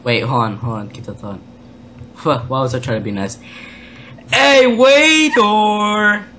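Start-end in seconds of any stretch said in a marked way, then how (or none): 4.3-6.2 long, drawn-out high holler; calling out to waiter. Pretend. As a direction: bellow for service, stretching each word out.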